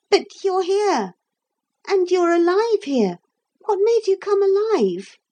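put-on high-pitched puzzled child; bewildered. A narrator's reading. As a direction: protest in high-voiced confusion. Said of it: "more and more bewildered".

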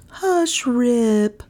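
lightly voiced mother, pitched high; crying out. A narrator's reading. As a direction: hush a child with a mild high cry. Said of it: "cried she".

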